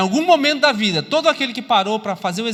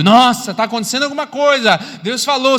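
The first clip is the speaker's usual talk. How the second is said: loud, higher-pitched burst of astonishment; amazed outcry; 3 semitones higher, 5 dB louder than usual. Acted. Onlookers sense that something is happening, loud amazed outcry. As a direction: exclaim it loudly, as a startled onlooker. Acts out bystanders hearing a noise.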